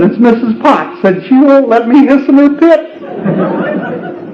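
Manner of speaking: high, whimpering little snake voice, slow at the end; whiny baby snake. Retold or imitated. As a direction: sniffle it out like a hurt little snake. Crying baby snake complains to mama.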